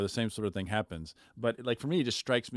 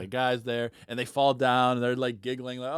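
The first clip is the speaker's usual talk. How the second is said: usual speaking voice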